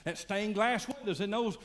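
building from plain into high, loud bragging; smug churchgoer boasting. Acted. Preacher voicing a listener bragging of family donations, building loud. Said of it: "You say".

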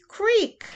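high creak; a high creaky bed noise. A narrator's reading. Bed sound effect.

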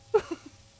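short, high, loud laugh. Real, not acted. Player amused by her own yelling at Lara, high laugh. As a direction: burst out laughing after your own shout. Laughs after shouting at the game.

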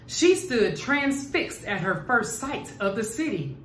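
ordinary delivery, nothing marked

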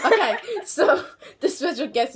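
laughing through the words. Real, not acted. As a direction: keep giggling as you talk. Still laughing at mix-up.

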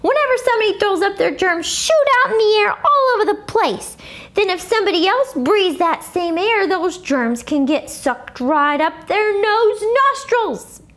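a hint of a know-it-all kid; voiced child. A narrator's reading. Character explaining germs, voiced.

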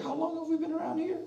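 high scoffing question; high, derisive mock question. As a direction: sneer at the believer. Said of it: "People will mock you up".

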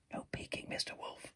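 hushed whisper; whispering warning. Acted. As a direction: breathe it so the wolf can't hear. Sheep hiding from wolf.

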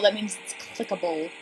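usual speaking voice

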